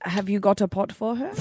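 softly tried French accent; French accent attempt. Shown as a demonstration. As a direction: have a tentative go at sounding French. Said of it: "how did you speak as Pascal?".

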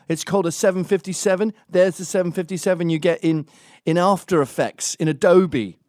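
loud, high-pitched, insistent truther rant; ranting conspiracy theorist. Acted. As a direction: hammer out your proof at high pitch and full volume.

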